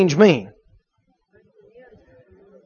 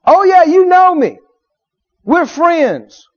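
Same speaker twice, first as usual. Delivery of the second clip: lightly acted caller, pitched higher; insistent stranger; 5 semitones higher, slower than usual. Unfamiliar caller persuades someone behind the door, insistent. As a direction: insist on being let in as a friend. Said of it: "an unfamiliar voice comes back".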